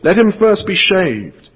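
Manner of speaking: high, loud cruel order; the witch ordering Aslan shaved, high and loud; witch's order. A narrator's reading. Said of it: "said the witch".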